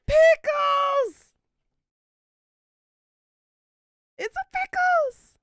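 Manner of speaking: high, loud, delighted shout; excited shout. Real, not acted. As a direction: call the name out in sudden delight. Excitedly greets Pickles arriving.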